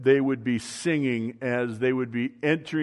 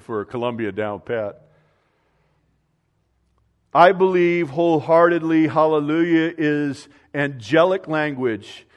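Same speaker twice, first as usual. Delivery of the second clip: ordinary delivery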